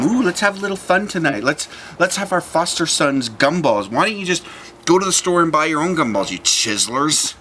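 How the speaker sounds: high, sly scheming couple voice; mock scheming parents. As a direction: tease it like plotting grown-ups. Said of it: "husband and wife team".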